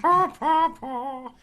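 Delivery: high, loud leprechaun voice; sick leprechaun. Pretend. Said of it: "Sound like a sick leprechaun".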